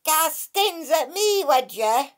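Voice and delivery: slow, high, scolding wee sea creature; the Dracai scolds Kate from the rock pool; grumpy water sprite. Acted. Scolding water fairy. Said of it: "said Kate, looking round to see where the voice had come from".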